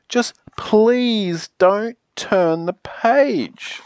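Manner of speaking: very slow, imploring request; drawn-out, emphatic begging; desperate plea. Acted. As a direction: beg word by word, stretching each one. Last bargain, begging.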